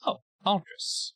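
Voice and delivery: eerie many-voiced entity, lightly voiced; a calm, knowing alien greeting an old friend; faceless creature voice. Creature addressing Aldris, a touch of eerie amusement.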